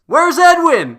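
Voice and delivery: sudden high, loud call out; mock yell. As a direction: bellow the question like searching a crowd. Running gag, yelling for missing player.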